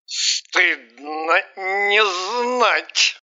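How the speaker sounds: put-on very slow, smug drunk; hammy stage actor. A narrator's reading. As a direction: drawl it smugly. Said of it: "икнул и добавил".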